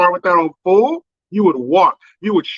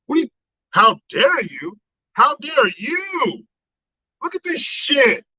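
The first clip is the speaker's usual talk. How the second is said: mock outrage; 8 semitones higher, slower than usual. Mock indignation.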